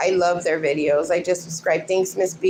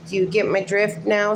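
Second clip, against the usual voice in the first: ordinary talk, nothing marked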